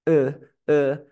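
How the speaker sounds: two loud schwas on "Eh, eh"; "Eh, eh" with a totally slack tongue; relaxed schwa. Shown as a demonstration. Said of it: "mi lengua está súper relajada". ("Eh, eh" con la lengua súper relajada)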